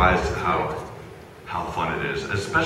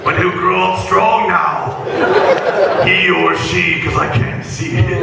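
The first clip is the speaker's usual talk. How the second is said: Vegeta voice; 12 semitones higher, 7 dB louder, slower than usual. Acted. Vegeta voice speaking to baby.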